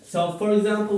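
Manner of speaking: usual speaking voice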